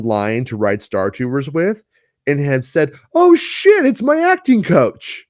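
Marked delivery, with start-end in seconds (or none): 3.1-5.3 high, loud mock shock; startled recognition. Friend spots acting coach in line, startled exclamation. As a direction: exclaim it high, stretching the end.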